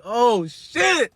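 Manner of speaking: high, drawn-out delighted exclamation; acted delight. Delight at smelling grandma's cooking.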